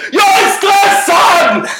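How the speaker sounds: high, slow, exasperated yell; frustrated shout. Retold or imitated. To his mom who can't hear him, a slow frustrated shout. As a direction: finally bellow it into the phone. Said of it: "Varför skriker du?".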